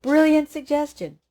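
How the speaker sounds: high, loud mock-bright hey; mock-sarcastic praise. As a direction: play it as ironic praise.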